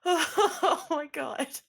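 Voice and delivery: slow, high groan of dread; drawn-out dread. Acted. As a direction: stretch it out in dread. Reacting to scream time.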